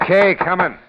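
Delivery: loud call toward the door. Acted. Detective calling to a visitor at the door, loud call. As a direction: shout it through the door, high and loud.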